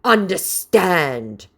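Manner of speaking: high, loud mock snarl, slowing at the end. Vlogger hamming up the scammer's snarl. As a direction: snarl it high and loud, drawing out the last word. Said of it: "snarled the scammer".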